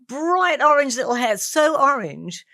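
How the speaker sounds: ordinary talk, nothing marked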